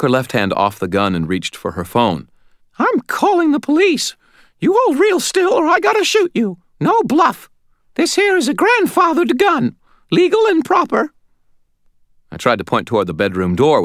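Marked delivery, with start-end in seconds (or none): 2.8-11.1 very high, loud, squeaky scolding; feisty old lady. A narrator's reading. Old lady phoning police at gunpoint, squeaky querulous threat. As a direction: warn him off in a squeaky old-woman voice.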